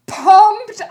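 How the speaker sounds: pumped, high and loud burst; excited shout. Real, not acted. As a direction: shout it out, thrilled. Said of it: "Brett, I am".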